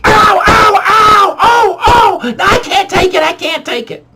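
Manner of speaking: very high, loud fake yelps of pain; mock screaming though it barely hurt; hammed-up ow. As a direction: overact the pain. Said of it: "And I'm like".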